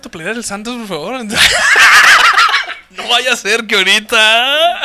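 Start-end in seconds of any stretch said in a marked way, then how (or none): 1.3-2.9 high, loud squeal of laughter; shrieking laugh. Real, not acted. Big laugh.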